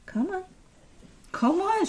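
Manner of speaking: high pet coaxing. Real, not acted. Urging her dog to climb up, high rising coo. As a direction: lilt it up high to urge the dog on.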